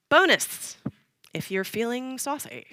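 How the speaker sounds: high cheeky bonus tip; saucy playful. Pretend. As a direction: toss it off cheekily.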